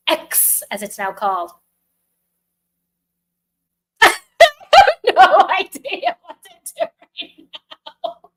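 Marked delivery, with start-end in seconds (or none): 3.9-8.4 laughing. Real, not acted. Laughing alone on stream.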